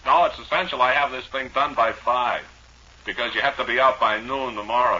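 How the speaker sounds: loud, high pushy bank man; pushy banker. Acted. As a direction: lean on him like a mortgage collector.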